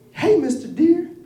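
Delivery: high, slow cartoon rabbit; squeaky little rabbit voice. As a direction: chipper high-pitched bunny. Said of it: "And the rabbit says".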